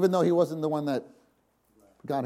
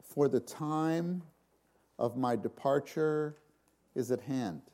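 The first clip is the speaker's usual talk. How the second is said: slow solemn reading; 2 semitones lower, 8 dB quieter than usual. Shown as a demonstration. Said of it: "listen, he says".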